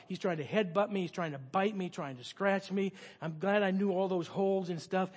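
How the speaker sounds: ordinary talk, nothing marked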